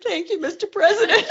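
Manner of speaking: high, laughing, flustered thanks; laughing retold reply. Reporter thanking the president in her story, high and laughing. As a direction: squeak it out through laughter.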